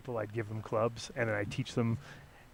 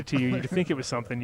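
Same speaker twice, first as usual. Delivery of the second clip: normal talking voice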